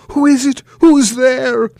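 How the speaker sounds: high, loud, scared cry; frightened. Acted. Herschel startled at night, high frightened cry. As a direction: call out into the dark.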